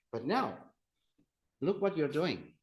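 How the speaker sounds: ordinary talk, nothing marked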